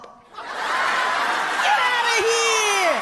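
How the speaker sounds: very high, drawn-out laughing yell; laughing shout. Real, not acted. At the president's Trump joke, a very high laughing shout. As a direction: cry out in disbelief through laughter. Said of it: "the laugh at the punchline".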